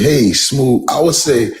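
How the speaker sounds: high, loud smooth-talker greeting. Pretend. Greeting the host he'd call Cool Breeze, smooth and high. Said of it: "I would call you".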